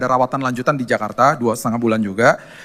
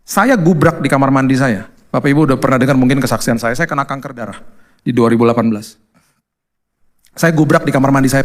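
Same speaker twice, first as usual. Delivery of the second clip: ordinary talk, nothing marked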